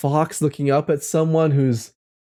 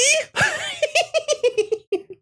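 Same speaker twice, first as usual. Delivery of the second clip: very high giggling fit; squealing laughter at your own silly voice. Real, not acted.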